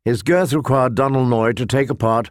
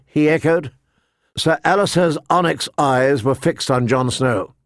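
ordinary delivery

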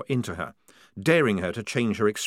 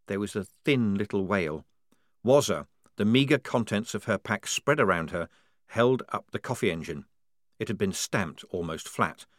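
ordinary delivery, nothing marked

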